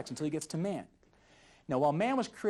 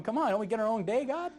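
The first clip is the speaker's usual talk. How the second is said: mock whiny protest; 8 semitones higher, 3 dB louder than usual. Boys complaining to God.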